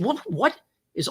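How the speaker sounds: sudden high, startled stammer; startled question. Retold or imitated. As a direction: caught off guard, voice jumps up. Startled officer retelling reaction.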